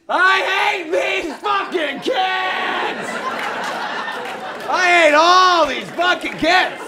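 long, high drawn-out shout; hateful yelling. Acted. Man on the tables at Chuck E. Cheese, yelling hatefully. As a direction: bellow it slowly to a whole room.

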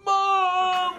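put-on very high, loud kid yell; shouted call to Mom. Little brother yelling for mother upstairs.

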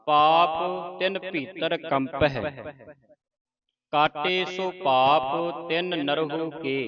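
slow, measured verse recitation; slow devotional reading; scripture recitation. Shown as a demonstration. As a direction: recite with long drawn-out vowels.

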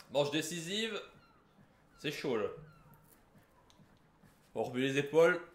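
ordinary talk

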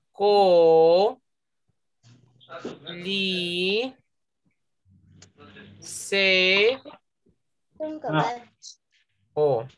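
CO, LI, SE, O, each drawn out with long pauses; syllable by syllable. Shown as a demonstration. Teacher splitting a word for pupils, syllable by syllable. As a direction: separate COLISEO into four held syllables. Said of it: "Entonces tiene cuatro sílabas".

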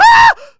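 real high loud shriek of fright; startled scream. Real, not acted. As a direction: scream from the jump scare. Jump scare in game. (驚いて本気の絶叫)

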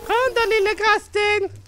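high, slow sing-song taunt; mocking taunt. Real, not acted. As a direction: call the monster out, teasing. Taunting a ghast in game. (retsamt lockrop)

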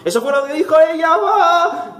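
high, fast mock cheer rising into a yell; hammed-up exclamation. Player crowing his running joke, hammed-up high yell. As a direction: blurt it high and stretch the final cry.

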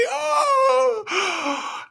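high stretch yawn sound; exaggerated waking oh; big stretching oh. Shown as a demonstration. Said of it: "stretch and say".

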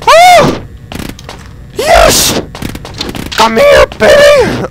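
very high, loud glee; excited shrieking. Real, not acted. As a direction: shriek it with joy. Finds a gun.

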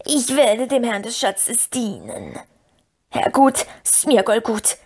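slow servile creature promise with throaty gulps; Gollum. A narrator's reading. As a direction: pledge service, drawn out and cringing.

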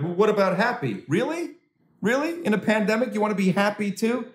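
high, incredulous whine; mock the outraged complainers; incredulous people. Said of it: "people be like".

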